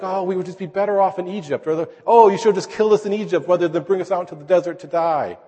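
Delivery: mock whining complaint. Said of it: "they grumbled, they whined".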